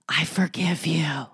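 slow, whispered forgiveness; intense whisper. Acted. Insisting while looking into someone's eyes, slow mock-intimate whisper. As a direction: whisper it slowly, as if deeply meant.

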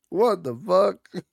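high, slow, strained gasp of shock; Idris Elba choking. Retold or imitated. Imitating a choking Idris Elba meme, high and slow. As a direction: choke the words out, stunned. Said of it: "It's like".

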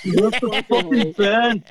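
slow, drawled cowboy line; high, slow cowboy drawl. Pretend. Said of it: "you got your cowboy voice on".

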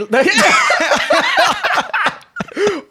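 high, long burst of laughter; laughing hard. Real, not acted. Host hearing Tyler Perry named as author, real laughter.

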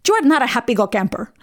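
heavy Colombian-accented mimicry of Mom; imitating Mom's Colombian accent. Daughter repeating Mom's mangled idiom, Colombian accent. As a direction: put on Mom's Colombian English. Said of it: "she spoke with a really heavy accent".

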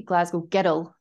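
put-on Glasgow girl in broad Glaswegian, glottal and clipped vowels; thick Glaswegian Glasgow girl. Shown as a demonstration. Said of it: "if I really sounded like I was from Glasgow".

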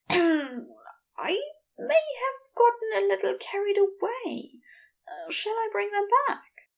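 high, coy character voice. A narrator's reading. Aziraphale admitting he got carried away, coy. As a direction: offer it coyly, pitched well up. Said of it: "smiled coyly and cleared his throat".

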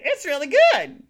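loud, high burst of praise; emphatic insistence. Retold or imitated. Library visitor insisting to a rude man, loud and emphatic.

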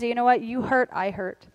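tender humble voice of the heart; humble and sincere. Retold or imitated. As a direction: speak gently, owning their hurt. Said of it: "heart posture that says".

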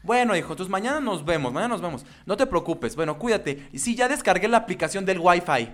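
dad voice. Retold or imitated. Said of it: "me habla y me dice".

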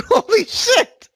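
loud laughing disbelief; laughing exclamation. Real, not acted. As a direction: burst out laughing in shock. Laughing reaction to weight gain.

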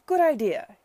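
loud, high, delighted cry; cried out. Acted. Babington driving toward the sea, loud delighted cry. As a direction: shout it with reckless glee. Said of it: "he cried".

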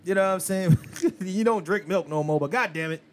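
ordinary talk

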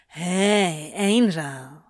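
low slow purr; seductive demon purr. Acted. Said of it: "he purred".

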